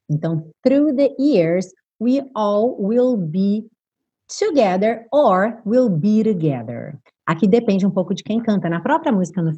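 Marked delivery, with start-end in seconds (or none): 0.6-7.0 slow lyric reading. Shown as a demonstration. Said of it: "teacher reads lyrics slowly".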